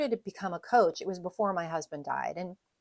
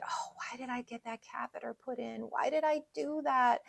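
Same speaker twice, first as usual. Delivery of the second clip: high, hushed inner lament; retold self-reproach; 5 semitones higher, 5 dB quieter than usual. Widow recalling her thoughts months afterwards, self-reproachful. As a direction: replay a regretful thought, lifted and soft at first.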